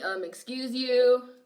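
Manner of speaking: slow, sassy excuse you; mock offended. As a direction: act scandalized, drawn out.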